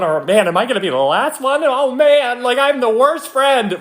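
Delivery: high, whiny panic, building; mock dismay. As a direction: act out a fretting, left-out groomsman. Voicing a worried groomsman.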